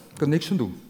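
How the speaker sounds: usual speaking voice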